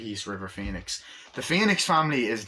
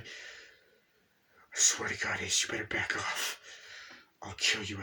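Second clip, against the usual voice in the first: quiet threat; 6 dB quieter, slower than usual. Acted. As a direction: warn him softly, gun in hand. Gun threat to Ace.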